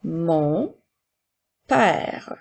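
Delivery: word by word with pauses; careful dictation for pupils to write. Shown as a demonstration. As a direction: dictate slowly, each word set apart. Teacher repeating dictated words.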